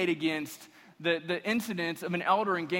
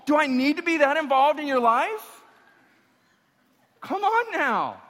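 much higher and louder, mock disbelief; incredulous preaching; 13 semitones higher, 8 dB louder than usual. Real, not acted. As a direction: scoff at the idea, voice climbing high. Preacher incredulous, mocking objection.

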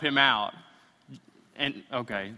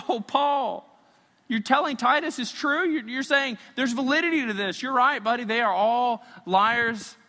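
mock listener reaction; 6 semitones higher, 3 dB louder than usual. Voicing a listener's reaction to Paul.

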